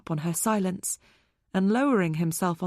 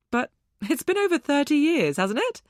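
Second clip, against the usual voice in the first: flippant; 7 semitones higher than usual. A narrator's reading. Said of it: "far too flippant".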